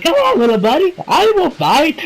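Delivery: high, silly imitated encouragement; playful coaxing. Goku offers his hand to the little leader, imitated high coaxing. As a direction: coax in a high mock-friendly voice.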